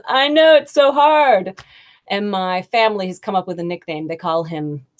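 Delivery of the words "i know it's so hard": higher, louder, light and easygoing; laughing it off. Retold or imitated. Husband laughing off his hard name, higher and louder. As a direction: shrug it off kindly. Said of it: "he laughs it off as".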